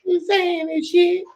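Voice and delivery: put-on high whiny child. Little boy whining back at his mama, high and teary. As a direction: whine it like a boy caught out.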